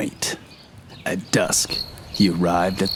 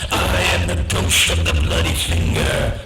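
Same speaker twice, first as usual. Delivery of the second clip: ghostly moan; 3 semitones lower than usual. A narrator's reading. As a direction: boom it out like a ghost in the room. Ghost pauses in doorway.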